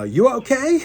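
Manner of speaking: very high, loud call from a distance; retold owner calling out loud and high; calling from the bank. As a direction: call down to someone in the water. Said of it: "I hear from the bank".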